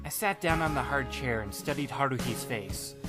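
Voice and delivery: ordinary delivery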